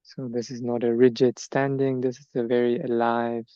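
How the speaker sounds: measured meditation guidance, long silences between phrases; guided meditation. Acted. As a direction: let each body part settle before the next. Leads standing meditation.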